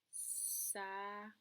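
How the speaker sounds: "Salade" drawn out as sa... la... de; sa, la, deuh, each on its own; syllable by syllable. Shown as a demonstration. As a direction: separate the three syllables slowly. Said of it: "combien y a-t-il de syllabes".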